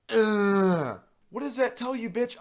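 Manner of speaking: high, slow, exasperated; sarcastic retort, drawn out; mock incredulous. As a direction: teller mocking the RA's pointless questions. Mocking RA's question.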